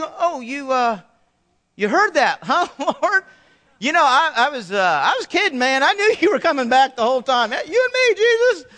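high, loud nervous backpedalling with a laugh; sheepish Thomas. Retold or imitated. Thomas caught out, sheepishly laughing it off to Jesus. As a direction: play it like a caught friend laughing it off, voice climbing high.

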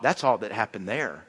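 ordinary talk, nothing marked

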